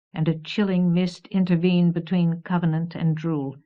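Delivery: usual speaking voice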